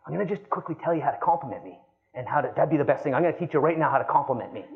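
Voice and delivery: fast mock pitch. Mocking pushy agent.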